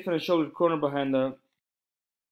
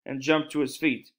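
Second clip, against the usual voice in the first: plain reading voice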